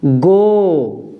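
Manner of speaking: गो said as "go"; teacher sounding out "गो"; ga with o matra. Shown as a demonstration. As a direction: model "गो" clearly. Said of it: "ग पे लगा दी तो".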